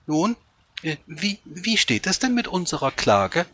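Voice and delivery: high, halting hen voice; fussy high-pitched clucking complaint; cackling hens. A narrator's reading. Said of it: "gackerten die Hühner".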